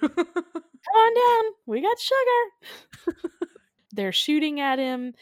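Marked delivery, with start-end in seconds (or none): 0.0-3.2 slow, drawn-out high holler; mock calling out. Luring the giant ant with sugar, slow mock holler. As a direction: sing it out like a far-off call, hammed-up.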